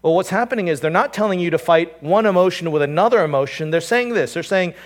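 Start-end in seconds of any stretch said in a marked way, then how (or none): none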